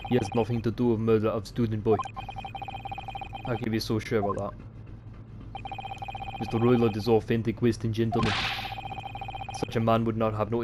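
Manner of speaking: put-on haughty Russian accent. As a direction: defend the gentleman like a Russian guard. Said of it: "I like to do Russian accents".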